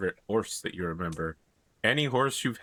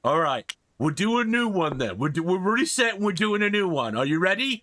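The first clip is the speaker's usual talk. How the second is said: troll voice; 8 semitones higher than usual. Acted. Troll resets the riddle.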